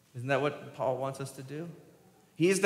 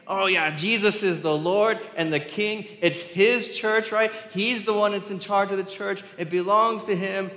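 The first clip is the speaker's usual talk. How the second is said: higher, louder mock-enthusiastic assent; eager agreement; 6 semitones higher, 5 dB louder than usual. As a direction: agree readily and loudly, playing the keen believer. Acts out easy, eager agreement.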